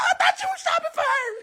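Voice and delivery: squealed taunt through laughter; high laughing shout. Acted. Comic mocking a rapper buying Chanel, high laughing shout.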